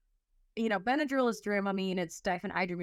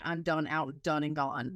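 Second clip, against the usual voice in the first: ordinary delivery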